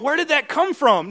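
high, loud mock astonishment; acted-out shocked question; startled disbelief. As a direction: blurt it, baffled at yourself. Acting startled at own outburst.

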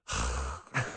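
long low exasperated sigh; deep weary sigh. Real, not acted. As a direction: let out a tired sigh. Exasperated reaction.

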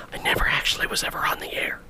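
whispered aside; breathy unvoiced whisper. Real, not acted.

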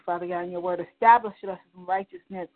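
ordinary talk, nothing marked

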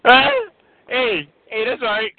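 ordinary talk, nothing marked

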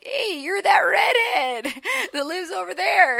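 high, slow laughing call from the street; half-laughing mock shout at a passerby; stranger calling out. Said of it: "somebody was like".

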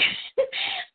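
high airy laugh; breathy high laugh. Real, not acted. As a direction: laugh it off, moved. Laughs about making each other cry.

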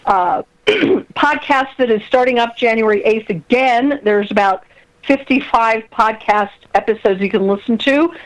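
ordinary delivery

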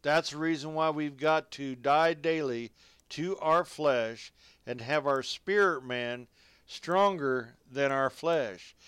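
ordinary talk, nothing marked

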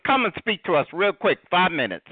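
ordinary talk, nothing marked

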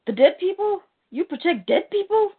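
high-pitched mocking questions; incredulous scoffing. Lobo challenging Clark's claim to protect people, acted scornful disbelief. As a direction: query it as if it were absurd.

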